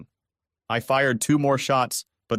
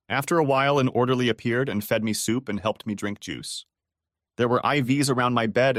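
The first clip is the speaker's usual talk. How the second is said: usual speaking voice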